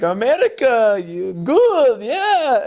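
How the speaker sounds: high, hammed-up admiration from local players; imitated cheering. Players praise Elder Hansen's outside shot, imitated cheering.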